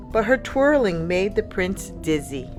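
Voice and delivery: plain reading voice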